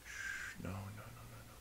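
soft unvoiced hush; whispered shushing. Real, not acted. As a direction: hush her gently back down. Calming sick partner.

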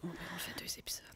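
soft unvoiced whisper. Real, not acted. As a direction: whisper it as an aside. Whispered aside.

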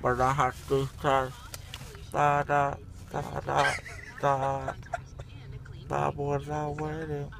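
groggy from anesthesia. Real, not acted. Drugged patient rambling.

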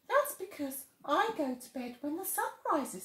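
a tiny animal explaining; bush baby voice. Acted.

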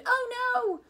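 high, loud anguished moan. Acted. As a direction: wail it in dismay. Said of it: "we moaned".

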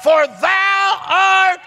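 high, loud, impassioned preacher shout; full-force preaching. Acted. Preacher assuring a dying man by scripture, shouted sermon. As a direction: build to long, drawn-out cries of scripture.

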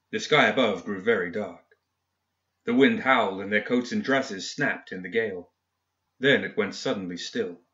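ordinary delivery, nothing marked

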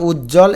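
উজ্জ্বল as "uj-jol", no b; silent b. Shown as a demonstration. Teacher breaking up the word, উজ্জ্বল without b. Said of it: "এই ব উচ্চারিত হবে না".

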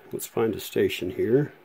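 ordinary delivery

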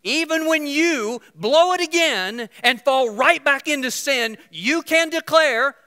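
loud, high, impassioned preaching; preaching at full force. Acted. Preacher urging the congregation, loud and high. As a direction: drive it home to the crowd.

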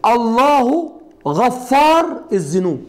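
slow model sentence. Shown as a demonstration. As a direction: lay out the example slowly for students. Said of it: "اقول لك ايه؟".

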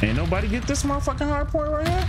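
high frustrated complaint; high pitched exasperated complaint. Real, not acted. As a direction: call out lazy teammates. Complaining at teammates.

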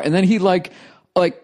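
ordinary talk

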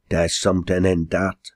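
plain reading voice

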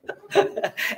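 short airy chuckle; soft breathy laugh. Real, not acted. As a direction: laugh quietly at your own joke. Laugh after a self-mocking joke.

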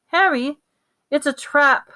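high, urgent warning; pained warning. A narrator's reading. Ron, clutching his leg, warning Harry, pained and urgent. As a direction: call it out as an alarm. Injured friend warns, in pain.

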